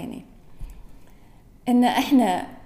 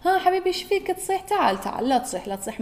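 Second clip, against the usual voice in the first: put-on soothing a crying child; 8 semitones higher than usual. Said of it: "يعني إحنا لما نشوف".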